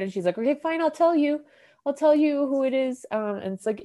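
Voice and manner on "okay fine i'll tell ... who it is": scared. Retold or imitated. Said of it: "she's, like, really scared".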